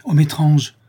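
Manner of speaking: a sharp indignant exclamation; indignant outcry. Acted. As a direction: exclaim it, outraged. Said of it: "s'écria le comte presque avec indignation".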